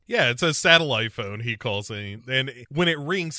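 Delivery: ordinary delivery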